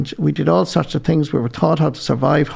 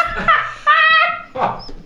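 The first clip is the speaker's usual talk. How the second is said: big laugh. Real, not acted. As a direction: laugh out at your own punchline. Laughing at own punchline.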